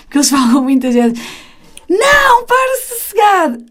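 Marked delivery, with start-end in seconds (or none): 1.9-3.6 imitated child's scolding yell, pitched way up; mock cross outburst, high and sharp; harsh scolding. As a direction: snap it high like a bossy child telling off a friend. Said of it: "quando tu falas desta maneira".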